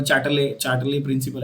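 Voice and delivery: चाटले said low with a hard CH and "lay" ending; offhand wrong चाटले, CH instead of SH; mispronunciation demo. Shown as a demonstration. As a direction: botch the name as चाटले, "chaat-lay". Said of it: "ऐसा कुछ नहीं बोलने का".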